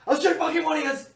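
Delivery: high, loud frantic waitress threat; waitress threatening. Acted. Waitress holding a revolver on both men, screaming high. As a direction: shriek it out while waving a revolver. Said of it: "she's pointing it ... going".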